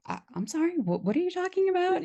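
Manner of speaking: high, stammering mock confusion; flustered apology. Young DJ caught off guard by the boss, flustered. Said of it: "I'm like".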